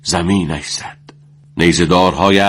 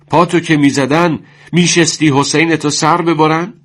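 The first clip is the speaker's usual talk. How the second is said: reproachful shout; 9 semitones higher than usual. A narrator's reading. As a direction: hammer him with reproaches. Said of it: "سر وحید فریاد کشید".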